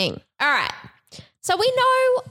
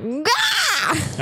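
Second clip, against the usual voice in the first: short sharp yell of fire; mock fiery shout; 9 semitones higher than usual. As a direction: burst out like a flame. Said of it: "water to my fire 'cause I'm like".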